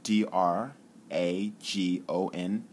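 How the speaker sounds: each letter slow and separate; spelled out. Shown as a demonstration. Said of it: "like Dragon".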